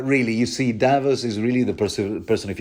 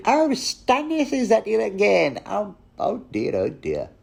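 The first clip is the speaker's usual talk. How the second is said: put-on much higher, tutting old-lady voice; fussy seamstress; 12 semitones higher than usual. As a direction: cluck like a weary seamstress downstairs. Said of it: "she's going".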